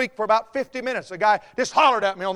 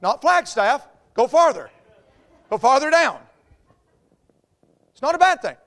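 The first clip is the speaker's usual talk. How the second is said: ordinary talk, nothing marked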